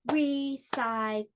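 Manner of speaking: "Recycle" slowly, one beat per syllable; three drawn-out, separate beats on "Recycle"; clapped-out syllables. Shown as a demonstration. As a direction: clap out "Recycle" as re, cy, cle. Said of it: "Let me clap that for us".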